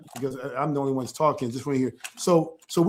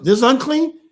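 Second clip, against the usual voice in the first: louder, higher, rising on unclean; surprised question; 6 semitones higher, 7 dB louder than usual. Real, not acted. Father checks his child's answer aloud, surprised question. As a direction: ask it in raised-voice surprise.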